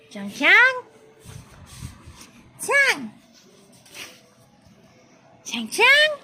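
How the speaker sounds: high, loud name call; high-pitched pet call. Real, not acted. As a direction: call the dog with a leap upward. Keeps calling the dog.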